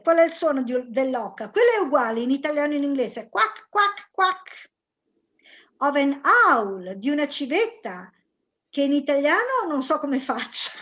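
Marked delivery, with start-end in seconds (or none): 3.3-4.7 duck quacking. Shown as a demonstration. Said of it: "What's the sound of a duck".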